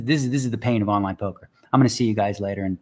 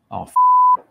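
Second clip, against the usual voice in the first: angry outburst; 8 dB louder than usual. Real, not acted. Software freezes mid-hand.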